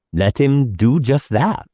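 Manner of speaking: usual speaking voice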